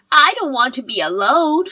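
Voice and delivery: loud, desperate wail of protest; desperate cry. Acted. Mother-in-law as family says goodbye forever, loud desperate plea. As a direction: cry out loudly as you're being abandoned.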